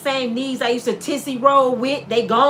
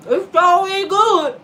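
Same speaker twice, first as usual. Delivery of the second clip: delighted Adam; 5 semitones higher, 5 dB louder, slower than usual. Acted. Adam tasting fruit, delighted.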